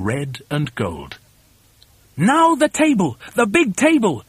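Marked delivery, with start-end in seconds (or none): 2.2-4.2 high, urgent command; high-pitched bossy shout; shouted order. A narrator's reading. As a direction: call out the next job excitedly. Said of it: "shouted Mugglewump".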